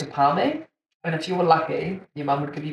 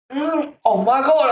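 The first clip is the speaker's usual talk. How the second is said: higher, slow moan of pleasure; mock food moan; 8 semitones higher, 3 dB louder, slower than usual. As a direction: savour a burger with a long moan. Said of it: "moaning into it".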